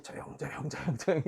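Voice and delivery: hushed, muttered imitation of secretive talk; mock whisper. Lecturer imitating people afraid of being overheard, mock whisper. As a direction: imitate someone keeping their words secret. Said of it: "속삭이듯이".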